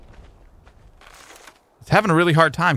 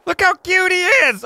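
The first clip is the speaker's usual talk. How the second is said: cooing; 3 dB louder than usual. Real, not acted. Cooing over tamed basilisk.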